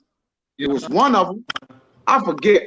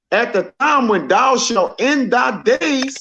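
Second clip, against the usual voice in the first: scripture reading; 6 semitones higher than usual. Acted. Reads scripture aloud.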